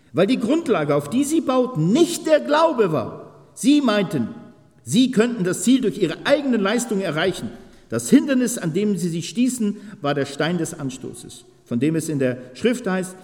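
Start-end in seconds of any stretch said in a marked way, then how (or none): 0.1-12.1 much higher, louder preaching; full-force preaching. Acted. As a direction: hammer the point home. (predigt mit voller Kraft)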